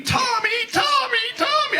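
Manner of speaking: very high shrieked calling of a name; hammed-up girlfriend screaming for her boyfriend; mock screaming girlfriend. Girlfriend screaming for Tommy in the water, mock shriek. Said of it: "my brother's girlfriend is screaming".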